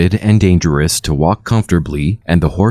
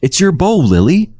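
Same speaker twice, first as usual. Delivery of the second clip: much higher excited calling; excited cry; 11 semitones higher than usual. A narrator's reading. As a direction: call out in discovery. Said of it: "Sandy shouted".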